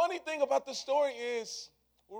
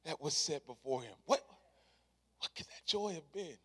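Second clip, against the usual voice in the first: quiet reflective preaching; 4 semitones lower, 3 dB quieter than usual. Acted. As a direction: let each phrase settle quietly. Soft reflective sermon opening.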